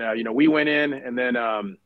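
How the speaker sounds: usual speaking voice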